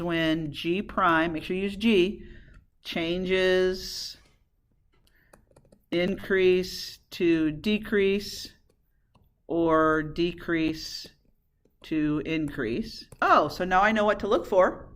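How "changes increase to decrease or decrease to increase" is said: slow, lowered, word-by-word delivery; slow dictation. Real, not acted. Teacher dictates the inflection rule as class writes, slow dictation. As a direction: spell it out at writing speed.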